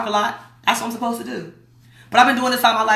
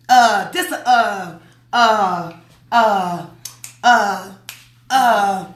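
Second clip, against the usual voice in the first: repeated stalled "uh", each falling away; searching for a lost word, with long gaps; groping for words; 3 dB louder, much slower than usual. Shown as a demonstration. What did I say this was?.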